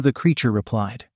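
ordinary delivery, nothing marked

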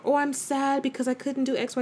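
a touch of a pouty self-pitying voice; lightly mocking her own everyday sadness; mock sad whine. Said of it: "everyday kinda cries".

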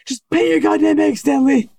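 high-pitched imitation of a cartoon character; hammed-up high cartoon outburst; exasperated cartoon quote. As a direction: blurt it out in a high mock-irritated voice. Acted South Park cartoon quote.

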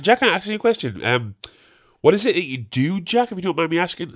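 high-pitched imitation of a chatty labourer; nosy gardener. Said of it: "replaced all the mates with Jack".

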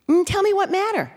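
high chirpy parrot voice; little birdie voice. Retold or imitated. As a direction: squawk it like a pet parrot. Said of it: "little birdie voice".

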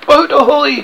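high, loud call to a boat; ringing hail. A narrator's reading. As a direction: hail a boat across the lagoon. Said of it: "again came the voice".

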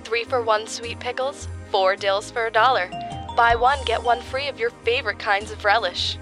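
high, loud store announcer; flat echoey pa page. Acted. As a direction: read it like a supermarket tannoy.

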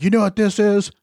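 mock yell, high and demanding; retold yelling. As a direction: demand an answer like a yelling dad. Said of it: "he's yelling at me".

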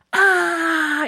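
sudden high yelp; high yelp of pain. Retold or imitated. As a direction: yelp as the cat grabs your leg. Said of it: "I'd be like".

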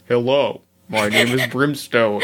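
low, put-on disguised superhero voice; distorted Brimstone voice. Podcaster secretly playing the superhero guest, low distorted voice. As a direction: deepen it like a voice being disguised. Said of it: "distort my voice".

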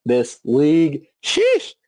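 Big Cat voice. Retold or imitated. Read tweet in Big Cat style.

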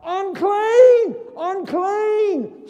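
high, loud warning call; crying out. Pretend. Leper warning passers-by, loud crying out. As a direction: shout it down the road to warn others. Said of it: "they had to cry out".

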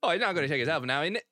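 mock British accent. Joking imitation of British speech to friends. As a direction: rattle it off like a London lad.

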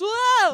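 sudden loud high yelp; high yelp of alarm. Retold or imitated. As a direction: yelp as you fall. Prisoner falling into fan.

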